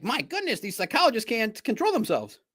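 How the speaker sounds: mock shocked. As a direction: exclaim in pretend scandalised disbelief.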